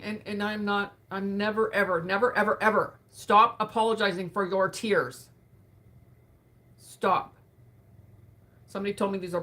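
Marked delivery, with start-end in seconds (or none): 1.1-7.3 louder, firm insistence; emphatic. Real, not acted. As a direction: drive home "never" and "Stop". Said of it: "insists never apologize".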